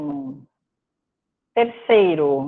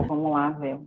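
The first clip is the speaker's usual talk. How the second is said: ordinary talk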